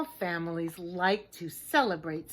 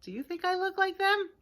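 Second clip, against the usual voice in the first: playful; 10 semitones higher, faster than usual. Acted. Teasing the listener.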